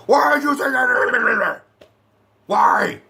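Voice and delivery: high garbled mock-furious shout; Batfleck. Reviewer mimics Batman losing it at Superman, hammed-up frantic shout. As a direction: lose it completely, words dissolving into a yell. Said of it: "fucking Batfleck loses his shit".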